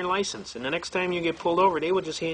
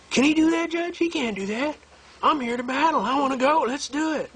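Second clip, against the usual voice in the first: eager to fight; 11 semitones higher than usual. Retold or imitated. Client pleading with the judge to let him fight, excitedly. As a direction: imitate him itching to battle, pitched way up.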